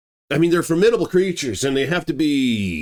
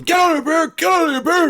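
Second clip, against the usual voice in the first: high, loud shout at an animal; yelling; 4 dB louder, faster than usual. Retold or imitated. Andrew yelling to scare off the bear, high loud shout. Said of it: "he started yelling".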